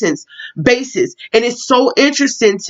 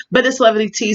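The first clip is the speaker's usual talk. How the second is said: ordinary talk, nothing marked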